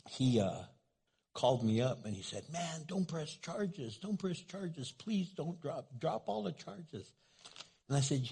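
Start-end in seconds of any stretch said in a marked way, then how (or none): 2.5-7.1 mock pleading, pitched higher and softer; pleading inmate. Inmate begging his pastor over the phone, mock pleading. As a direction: play the begging caller, a touch whiny.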